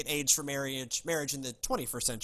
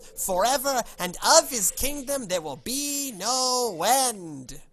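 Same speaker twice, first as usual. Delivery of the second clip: high-pitched, loud New Yorker angel; New York accent; 7 semitones higher, 4 dB louder than usual. Acted. Angel Gabriel announcing a son to Mary, booming New York accent. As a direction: proclaim it grandly as a New Yorker. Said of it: "I play him as a New Yorker".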